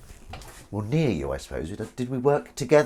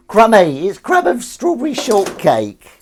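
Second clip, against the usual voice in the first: high, slower squeal at the dog; squeaky pet voice; 11 semitones higher, 9 dB louder, slower than usual. Pretend. Squeals at the dog's crumb.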